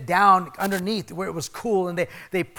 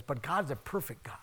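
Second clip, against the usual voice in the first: whisper; 4 semitones lower, 11 dB quieter than usual. Real, not acted. Preacher drops to a whisper.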